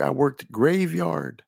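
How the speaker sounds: ordinary delivery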